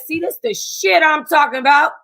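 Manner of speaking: mock indignant, up in the voice; sassy retort. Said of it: "I said".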